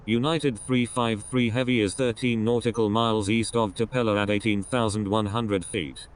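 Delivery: usual speaking voice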